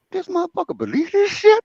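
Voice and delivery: high, disbelieving inner voice; can't believe he bought it; squeaky disbelief, a laugh behind it; incredulous. Retold or imitated.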